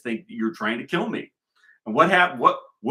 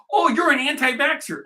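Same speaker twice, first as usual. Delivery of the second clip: mocking critic; 13 semitones higher, 5 dB louder than usual. Mocking the Chinese commenter.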